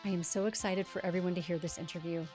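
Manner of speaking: polished scripted host read; podcast intro read. Acted. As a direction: read the intro like a show opener. Scripted podcast intro over music.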